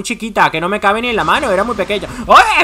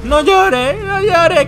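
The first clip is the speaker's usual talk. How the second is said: high, coaxing plea to the turtle; mock comforting; 9 semitones higher, 3 dB louder, much slower than usual. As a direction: soothe it like a crying child, half joking. Consuela a la tortuga. (consuelo fingido y agudo)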